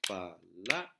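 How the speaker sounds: "palabras" as pa... LA... bras, with gaps; split into syllables. Shown as a demonstration. Teacher showing the clapping trick, syllable by syllable. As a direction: break "palabras" into three spaced beats, LA held longer. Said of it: "haciendo el truco de aplaudir".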